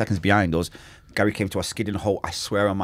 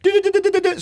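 high rapid du-du-du burst; mock gunfire; 4 dB louder, faster than usual. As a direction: spit out the shots like a machine gun. Imitating gunshots in a story.

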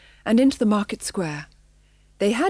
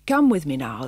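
ordinary delivery

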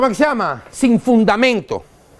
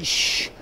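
air syringe hiss; 8 dB quieter than usual. Shown as a demonstration.

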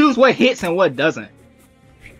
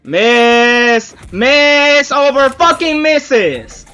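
drawn-out frustrated yell; 5 semitones higher, 4 dB louder, much slower than usual. Real, not acted. Keeps missing attacks.

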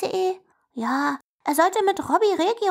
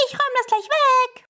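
higher, called while passing by; calling out in passing; 7 semitones higher than usual. Acted. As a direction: shout it over your shoulder. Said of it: "ruft Simsalasumm im Vorbeifahren". (Simsalasumm ruft im Vorbeifahren)

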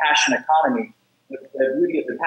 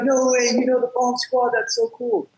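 much higher, amazed, giddy; thrilled student; 9 semitones higher than usual. Retold or imitated. Student hearing his speaker knows the Bomb Squad, much higher and thrilled. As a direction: gush like a starstruck kid.

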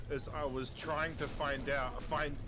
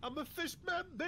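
gurgling fish man croak; 7 dB quieter than usual. Pretend. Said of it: "Listen to me go blub".

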